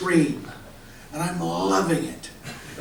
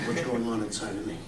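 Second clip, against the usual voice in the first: usual speaking voice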